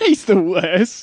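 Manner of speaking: laughing through the words; giggling accusation. Real, not acted.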